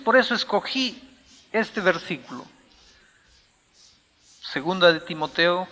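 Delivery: ordinary talk, nothing marked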